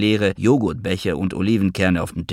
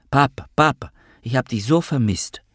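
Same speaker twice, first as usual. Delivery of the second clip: put-on high, childlike calling up to her papa; a small girl tugging at a jacket, calling up; little girl calling up to her father; 6 semitones higher than usual. A narrator's reading. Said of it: "rief sie immer wieder nach oben".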